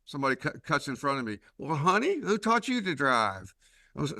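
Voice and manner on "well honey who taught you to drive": higher, sweet-talking Southern accent, mock-kindly; a Southern driver sweetly scolding another car; Southern accent, honey-coated jab at a bad driver; Southern drawl at traffic. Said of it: "this will show how Southern I am".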